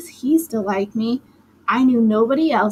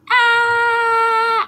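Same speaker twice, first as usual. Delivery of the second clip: high piercing scream, much louder; 12 semitones higher, 5 dB louder than usual. A narrator's reading. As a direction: let out a long shrill scream of panic. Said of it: "I kept screaming".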